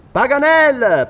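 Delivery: loud hail to a hidden friend; calling out. Acted. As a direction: shout his name up to the treetop. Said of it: "gridò Glenarvan".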